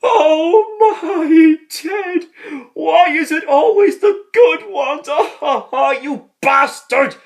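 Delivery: hammed-up sobbing priest; mock wailing grief. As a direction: wail it in fake grief. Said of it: "your man was like".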